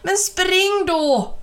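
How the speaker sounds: slow, high and loud urging; urgent shout. A narrator's reading. Jula yelling again at the fleeing children, slow loud shout. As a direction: drag the shout out, pleading loudly. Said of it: "hörde han Jula skrika".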